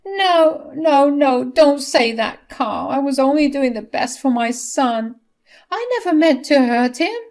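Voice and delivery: high, loud begging wife; tearful plea. Acted. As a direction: beg your husband not to leave you. Nina denies to Carl.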